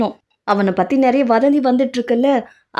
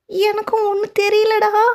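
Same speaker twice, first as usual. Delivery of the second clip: much higher, slow, put-on voice; feigned innocence; 11 semitones higher, slower than usual. Mother-in-law pleads she knows nothing, high slow feigned innocence. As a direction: play dumb.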